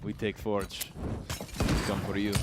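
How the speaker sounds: put-on a hammy, low Latvian accent. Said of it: "if that's a Latvian accent at all".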